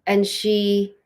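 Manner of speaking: ordinary talk, nothing marked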